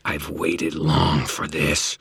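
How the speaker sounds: very slow, purring, malevolent inhuman voice; purring alien voice. Acted. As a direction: savour every word like a predator. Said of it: "the alien voice, that of the nightmare, purred".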